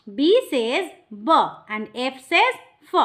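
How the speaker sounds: "বি says ব and এফ says ফ", each letter sound said very slowly; slow phonics drill. Shown as a demonstration. Teacher drilling letter sounds for a child, very slow. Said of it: "phonics demo".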